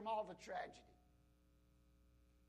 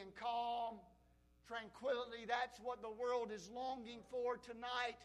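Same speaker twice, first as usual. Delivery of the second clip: ordinary talk, nothing marked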